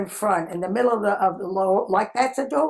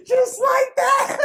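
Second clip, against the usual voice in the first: high, helpless laughing words; 4 dB louder, slower than usual. Real, not acted. Storyteller tickled by her own threat, laughing. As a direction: squeeze the words out through real laughter.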